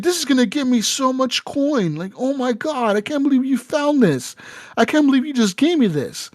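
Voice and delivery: high-pitched mock delight; acted excitement. Quest giver handed the mystery liquid, acting thrilled. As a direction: gush with disbelief, pitched well up.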